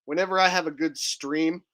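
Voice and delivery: ordinary delivery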